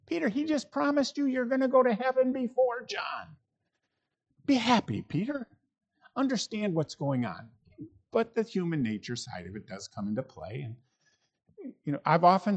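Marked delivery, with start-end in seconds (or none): 0.1-7.5 high, pressed disbelief at Peter's question; exasperated retort. Retold or imitated. Preacher voicing Jesus rebuking Peter, high incredulous retort. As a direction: push back, incredulous, pitch way up.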